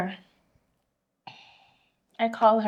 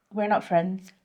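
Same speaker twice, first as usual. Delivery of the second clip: cold; 3 semitones lower, 5 dB quieter, slower than usual. Retold or imitated. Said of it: "the voice changed".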